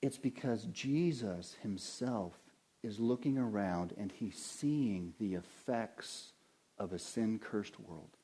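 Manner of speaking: normal talking voice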